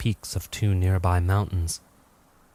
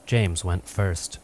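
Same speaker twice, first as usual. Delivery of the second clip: usual speaking voice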